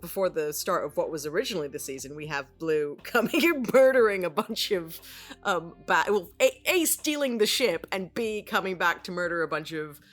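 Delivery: ordinary delivery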